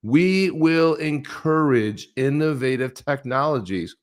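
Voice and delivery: high, stretched "We will encourage innovative technologies"; pointed slow emphasis. Acted. Host mocking the buzzwords, slow stress on "encourage". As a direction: spell out "encourage" slowly, high and deliberate, word by word. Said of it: "Look at this word here".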